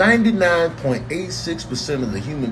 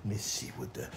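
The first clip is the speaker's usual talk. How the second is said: soft, slow, menacing murmur; a quiet, slow, ominous cat voice; sinister cat; 14 dB quieter than usual. Acted. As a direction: sniff out treachery. Voicing a suspicious cat.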